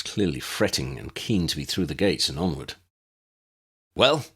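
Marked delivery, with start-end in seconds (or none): 3.9-4.3 demanding. A narrator's reading. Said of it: "he demanded".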